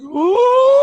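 high, loud, strained scream of pain. Retold or imitated. Bitten guy screaming as he gets bitten. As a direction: let out a sharp high yelp of pain.

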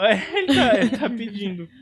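laughing. Real, not acted. Laughing at the joke.

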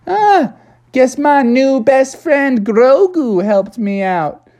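high, slow cartoon voice; proud Froggy. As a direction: stretch it out in a high, pleased frog voice. Froggy proudly explains the catch.

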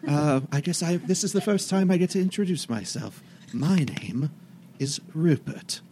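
lightly voiced polite ghost; ghost butler. GM voicing ghost butler Rupert.

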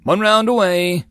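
high, loud gunner's call; warning shout. A narrator's reading. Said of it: "the gunner warned".

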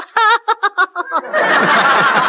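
high, far hen cackle; hen clucking. Shown as a demonstration. Actor does a hen laying an egg for the host. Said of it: "hen laying an egg".

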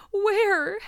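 very high, loud mock wail; sobbing. A narrator's reading. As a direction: wail it like a distraught schoolgirl. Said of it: "sobbed Carrie Sloane".

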